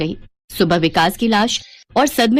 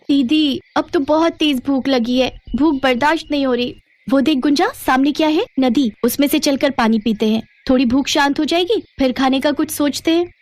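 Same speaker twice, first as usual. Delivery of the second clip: put-on higher-pitched girlish voices; hungry young girls; 5 semitones higher than usual. Hungry girl and elder sister in the wild, high girlish voice. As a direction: talk like children on a long walk.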